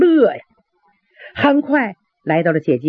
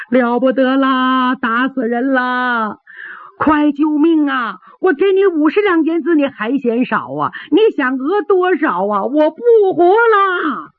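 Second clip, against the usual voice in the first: high, slow, drawn-out theatrical wail; loud wailing; 6 semitones higher than usual. A narrator's reading. Slapped traveler wailing to draw a crowd at the gate. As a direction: bawl for help with stretched, crying endings. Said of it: "放声大哭啊".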